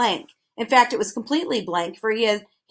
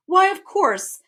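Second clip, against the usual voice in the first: cheerful; 10 semitones higher, 3 dB louder, slower than usual. A narrator's reading. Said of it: "said the humbug cheerfully".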